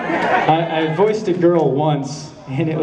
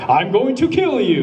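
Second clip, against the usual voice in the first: higher, hammed-up evil threat; melodramatic villain; 8 semitones higher than usual. Shown as a demonstration. Voice actor showing the over-the-top evil the Japanese wanted, melodramatic. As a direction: ham up a stage villain's threat. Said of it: "really melodramatic".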